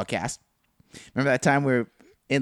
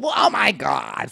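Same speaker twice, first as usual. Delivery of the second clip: put-on drawn-out valley-girl gush; ditzy blonde voice; slower than usual. Friend imitating the blonde girlfriend, slow ditzy voice. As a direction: stretch it slowly like a ditzy blonde. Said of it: "fucking that kind of voice".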